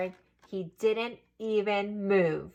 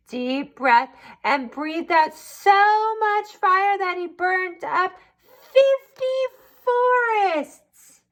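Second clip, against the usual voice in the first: rising higher and louder; dramatic build; 9 semitones higher, 3 dB louder, slower than usual. A narrator's reading. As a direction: build the fire to a big finish. Dramatic narration of fire.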